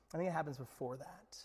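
hushed whisper. Real, not acted. Preacher confiding to the congregation, whispered. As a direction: lean in and whisper it.

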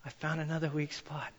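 soft and gentle, hushed down; gentle shepherd voice, quiet and tender; gentle God voice. Pretend. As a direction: tell him kindly, almost a whisper. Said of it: "he goes".

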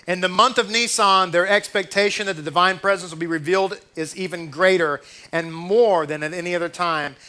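a loud, measured reading of the commentary. Acted. Rabbi reading the commentary to his congregation, loud and measured. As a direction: read it out to the room, emphatic.

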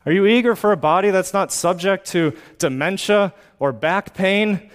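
ordinary delivery

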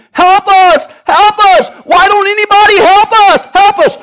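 high, loud panicked screams for help; desperate shouting. Acted. Bitten father begs passers-by for help, desperate shout. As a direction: shout frantically, pleading at full force.

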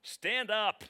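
booming, abrupt order to get up; God's command. Acted. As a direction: shout it as a sudden divine command. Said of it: "the Lord said to Joshua".

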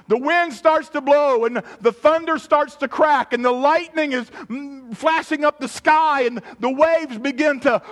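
loud, high, driving sermon delivery; full-force preaching. Acted. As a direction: preach it loud, pitched well up.

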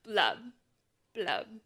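low glum blub; gurgling fish man croak. Acted. Fish bubbles in the story.

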